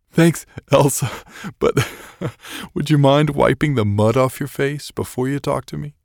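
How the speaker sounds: high, loud words broken up by laughter; laughing uncontrollably. A narrator's reading. David replying to Elsa after surviving, laughing through his words. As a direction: barely get the words out for laughing. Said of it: "he could barely get his words out".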